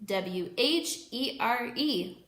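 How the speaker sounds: slow, letter by letter; spelling out. Shown as a demonstration. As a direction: spell each letter clearly for kids. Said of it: "Say it with me".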